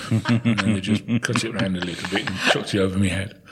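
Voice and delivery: normal talking voice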